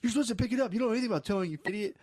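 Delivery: high-pitched mock rant at a tow driver; imitated screaming. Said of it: "this guy starts screaming at the towing guy".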